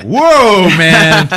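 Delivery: loud mock surprise; startled whoa. Phasma reeling from Finn's charge, loud startled whoa. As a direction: react like you nearly got hit. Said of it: "it's like".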